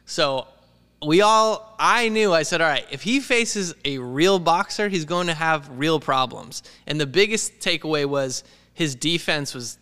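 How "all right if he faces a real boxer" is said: normal talking voice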